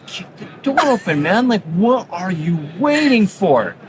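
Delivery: egging her brother on, lightly impatient; imitating an impatient daughter at bedtime; impatient sister. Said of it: "she's, like, getting impatient".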